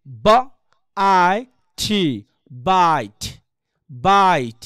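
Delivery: "বাইট" sounded out, then blended with a gliding AI; diphthong demonstration. Shown as a demonstration. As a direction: stretch the AI in "বাইট", letting it fall. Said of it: "diphthong হলে কেমন হবে?". ("বাইট", আই টেনে diphthong উচ্চারণ)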